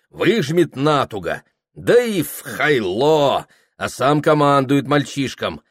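ordinary delivery

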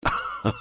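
short, mean laugh; spiteful little acted laugh; malicious laugh. A narrator's reading. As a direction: laugh nastily, briefly. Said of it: "با بدذاتی خندید".

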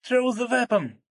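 very high, loud cry in English; piercing scream. A narrator's reading. Margo to the soldiers, piercing scream. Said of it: "пронзительно закричала Марго солдатам".

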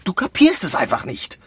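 angry rebuke, losing patience; exasperated scolding. Acted. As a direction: let the frustration burst out. Scolding a failed colleague.

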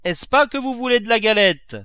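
high, loud holler across a distance; shouted question. A narrator's reading. Cook asking the young mistress about cake, high shouted question. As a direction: call the question out through a window. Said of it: "cria-t-elle par la croisée".

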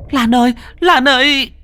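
high, loud panicked call; alarmed shout. Acted. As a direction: yell her name in shock. Onlookers cry out to Lan. (hốt hoảng gọi to tên Lan)

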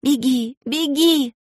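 high, urgent cry; urgent shout. Acted. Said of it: "Алиса кричала".

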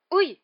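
sharp, high, loud "ouch"; startled yelp of pain. A narrator's reading. Boy hit on the head by a leaping creature, pained yelp. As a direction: cry out as if suddenly hit.

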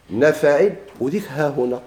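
normal talking voice

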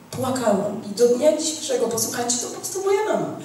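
ordinary talk, nothing marked

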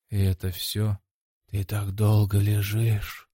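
slow, sombre voice with a faint hiss; gloomy, slightly hissing voice. A narrator's reading. Inner voice taunts the knocked-down fighter, gloomy and slightly hissing. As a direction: draw it out darkly, hissing a little. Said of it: "мрачный, немного шипящий голос".

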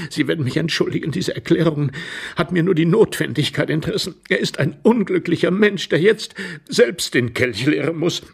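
high, plaintive, mildly tearful; voice failing, tearful. A narrator's reading. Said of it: "begann die Stimme des Herrn im Waschbärpelz zu versagen". (klagend, den Tränen nahe)

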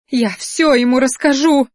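high, petulant wail; lightly acted tearful protest; whimpering threat. A narrator's reading. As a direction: cry it out as an upset threat. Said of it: "всхлипывала она".

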